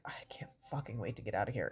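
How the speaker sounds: fast, frustrated whisper under the breath. Retold or imitated. Waiting for papers, whispering frustration to herself. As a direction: hiss it to yourself impatiently.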